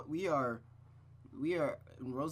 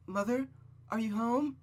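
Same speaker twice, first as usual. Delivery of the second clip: put-on high, questioning girl's voice; girl calling out; 9 semitones higher, 5 dB louder, slower than usual. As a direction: call tentatively into the house for mother. Girl calls for absent mother.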